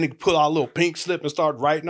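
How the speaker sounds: ordinary delivery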